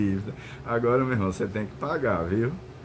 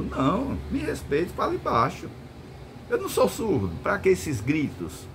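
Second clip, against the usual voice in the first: higher, firm rebuke to a loudmouth; firm vigilante; 5 semitones higher than usual. Retold or imitated. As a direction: put the shouting cop in his place, voice raised in pitch. Vigilante answers shouting officer. (vigilante firme e mais agudo repreendendo o policial)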